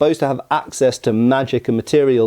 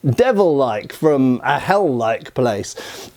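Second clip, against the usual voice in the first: ordinary talk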